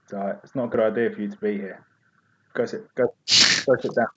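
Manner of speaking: quiet warning. Retold or imitated. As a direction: tell him off quietly but firmly. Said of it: "I was like".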